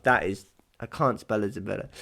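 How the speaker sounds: ordinary delivery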